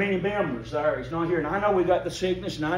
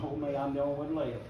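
whining self-pity; 3 semitones lower, 8 dB quieter than usual. Acted. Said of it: "whining and crying and moaning".

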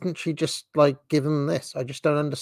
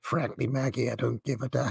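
softer mock Reagan accent, half under the breath; a hushed, teasing take on a Reagan accent; 7 dB quieter than usual. Vlogger jokingly voices Reagan replying to Maggie, softer mock Reagan accent. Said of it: "Can you do that accent again?".

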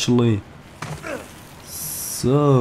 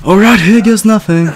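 excited; 11 semitones higher, 8 dB louder than usual. Real, not acted. Player psyching up for a jump.

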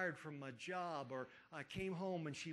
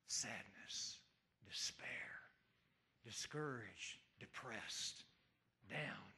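low sad words; 8 semitones lower, 5 dB quieter, slower than usual. Acted. Listing the disciples' dark feelings.

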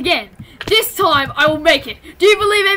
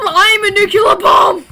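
excited shout; 5 semitones higher, 10 dB louder than usual. Real, not acted. Shouting in game.

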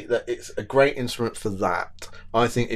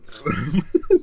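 sudden snort of laughter; amused snort; 3 semitones higher than usual. Real, not acted. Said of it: "Did you just snort?".

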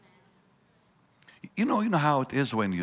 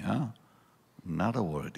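ordinary talk

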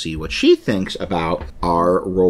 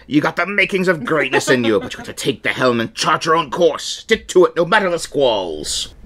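higher, lightly voiced pirate mentor; a hint of a pirate giving advice; 6 semitones higher than usual. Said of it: "I was being piratical".

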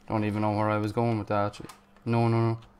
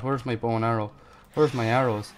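ordinary delivery

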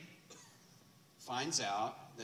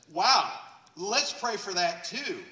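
eager wow; 8 semitones higher, 11 dB louder, slower than usual. Retold or imitated. Jessica seeing upside-down elbow.

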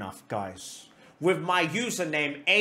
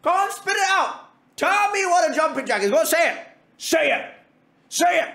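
higher-pitched, insistent prodding; playful goading; 9 semitones higher, 3 dB louder than usual. Acted. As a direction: egg them on, pitched up. Goading Zara to answer.

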